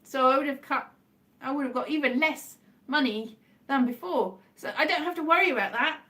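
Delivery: ordinary talk, nothing marked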